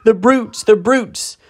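put-on high, anguished girl's cry; tearful outrage. A narrator's reading. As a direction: cry out at the cruelty through tears. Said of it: "tears streaming down her cheeks".

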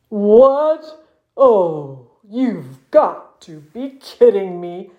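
slow, dragged-out disbelieving muskox; exasperated. A narrator's reading. Muskox blocked just as he wanted it over, exasperated. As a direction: groan it out in weary disbelief.

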